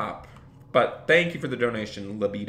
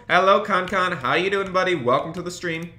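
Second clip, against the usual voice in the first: bright, higher, eager hello to a viewer; excited greeting; 6 semitones higher, 5 dB louder than usual. Real, not acted. Streamer excitedly greets viewer Con Con.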